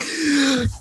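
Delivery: high, sharp gasp; acted gasp of panic; mock startled gasp. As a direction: gasp as if suddenly creeped out. Retelling panic in whisper room.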